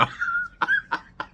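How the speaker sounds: high squealing laugh of delight; delighted squeal of laughter. Real, not acted. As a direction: squeal with real joy at the gift. Delighted by a Dunkin gift card.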